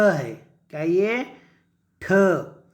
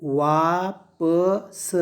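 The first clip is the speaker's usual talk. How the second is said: very slow, syllable by syllable; sounding out; much slower than usual. Shown as a demonstration. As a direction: break it into separate drawn-out sounds. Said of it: "क्या पढ़ेंगे".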